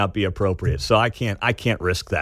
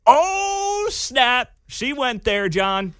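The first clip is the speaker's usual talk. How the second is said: high, drawn-out "oh snap" reaction; mock shock; 10 semitones higher, much slower than usual. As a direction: react as if scandalised, pitched way up. Mock shock at the article's jab.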